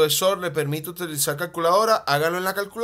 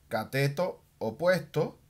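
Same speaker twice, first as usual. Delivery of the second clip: slower and softer, dictating the words "cateto opuesto"; slow dictation; 2 semitones lower, 4 dB quieter, slower than usual. Shown as a demonstration. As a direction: say "cateto opuesto" slowly as if writing it on the board. Teacher repeats term while writing. (dictando despacio y más suave "cateto opuesto")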